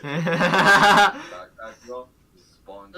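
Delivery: bursting out laughing, high and loud. Real, not acted. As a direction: blurt it through a big laugh. Joking about planted cannabis.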